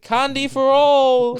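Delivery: high, drawn-out gleeful holler; booming character shout. Retold or imitated. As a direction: call it out big, stretching the last word. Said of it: "He's like".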